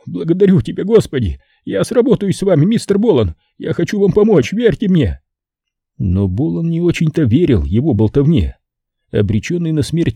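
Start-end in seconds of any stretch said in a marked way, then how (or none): 0.1-5.2 high, frantic begging pimp voice; a terrified bar owner offering all his money; panicked pimp pleading. Acted. Terrified Simon begging the killer to take the money, high and frantic.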